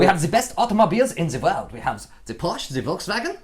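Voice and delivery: mock German bragging; hammed-up German accent; cod German accent. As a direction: boast in a piss-take German accent. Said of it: "I could do a cod accent".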